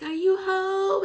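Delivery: high, drawn-out holler; calling out. Retold or imitated. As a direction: imitate calling into a house. Relatives call into the house.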